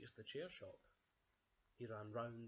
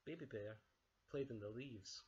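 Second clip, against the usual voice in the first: ordinary delivery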